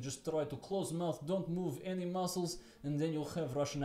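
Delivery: Russian accent through a nearly closed mouth. Shown as a demonstration. Showing listeners how to do the accent, Russian accent. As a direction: do a Russian accent, lips barely moving. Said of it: "If you want to do a Russian accent".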